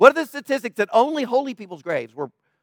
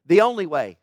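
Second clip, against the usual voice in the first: usual speaking voice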